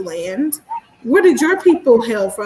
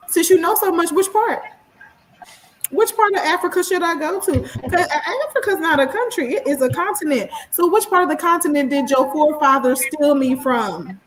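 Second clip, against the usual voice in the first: rhetorical comeback; 6 semitones higher than usual. Retold or imitated. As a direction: challenge them with a mock-innocent question. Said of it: "my rebuttal was that was".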